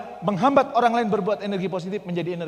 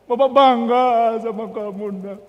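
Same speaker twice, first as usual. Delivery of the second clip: touched pride; 8 semitones higher, 11 dB louder, slower than usual. Retold or imitated. Father proud, repeats slower.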